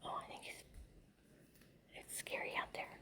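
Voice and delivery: ordinary talk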